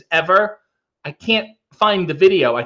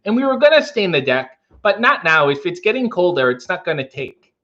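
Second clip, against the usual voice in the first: second try at a Minnesota accent; 2 semitones higher than usual. Shown as a demonstration. Retrying the accent with deck talk, Minnesota accent. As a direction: chat about chores in Upper Midwestern tones. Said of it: "mine was a little too much Wisconsin".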